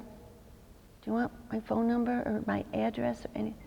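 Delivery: barely voiced mock-polite whisper; sarcastic thought under the breath; whispered sarcasm. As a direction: whisper the comeback you never said aloud. Unspoken sarcastic retort, only looked.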